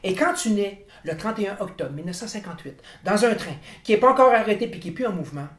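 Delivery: ordinary talk, nothing marked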